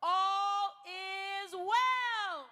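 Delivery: very slow, high, shouted final affirmation; the speaker ends the crowd mantra with a long, high shout; big-voice closing shout. Shown as a demonstration. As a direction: stretch it out and shout it to the rafters. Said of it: "Out loud, big voice".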